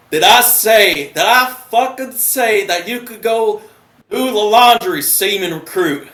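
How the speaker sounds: high, loud Southern scolding; thick Southern accent. Retold or imitated. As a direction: imitate an irate Southern petty officer. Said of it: "He's got the thickest Southern accent".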